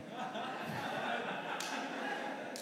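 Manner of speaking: high airy burst of laughter; big laugh. Real, not acted. Preacher laughing at his own story. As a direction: laugh out at himself.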